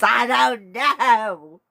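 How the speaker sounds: loud, drawn-out playful whine; mock wail. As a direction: wail it slowly in mock despair. Mock lament about making friends.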